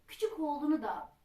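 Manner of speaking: usual speaking voice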